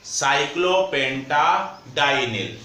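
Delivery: "साइक्लोपेंटाडाइनिल" said slowly in clear chunks; slow repeat. Shown as a demonstration. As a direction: dictate "साइक्लोपेंटाडाइनिल" slowly for note-takers. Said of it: "इस ligand का नाम होता है".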